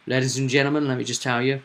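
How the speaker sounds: ordinary talk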